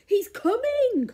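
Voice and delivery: high, slow urgent warning; alarmed warning. Acted. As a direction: warn in a sudden fright. Giantess alarmed, warning Jack.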